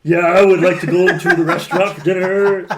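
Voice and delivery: mock gravelly jazz singer talking. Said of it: "I wanna hear him talk".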